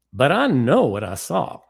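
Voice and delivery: a mild impression of a Southern friend who is certain; Southern accent. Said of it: "my bad impression of Ricky".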